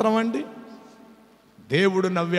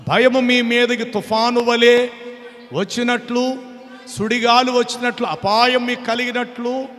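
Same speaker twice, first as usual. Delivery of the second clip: higher, louder, slow scripture proclamation, near a shout; louder and higher, preaching the storm of judgement; preaching at full force; 6 semitones higher, 5 dB louder than usual. Acted. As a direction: thunder out God's warning.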